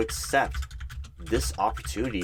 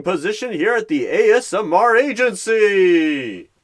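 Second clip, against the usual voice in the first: loud, high, booming host reveal; gleeful game show announcement; 8 semitones higher, 12 dB louder than usual. Acted. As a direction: announce the prize like a quiz host. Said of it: "announced gleefully".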